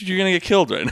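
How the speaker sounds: retold panic of a woman waking in a dark alley; mock panic. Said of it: "Like, freaking out".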